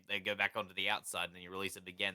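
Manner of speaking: ordinary delivery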